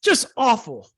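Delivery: high, drawn-out groan of dismay; mock lament. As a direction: wail it like a disgusted fan. Co-host mock lament opener.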